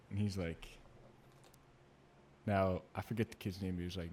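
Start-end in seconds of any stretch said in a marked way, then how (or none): none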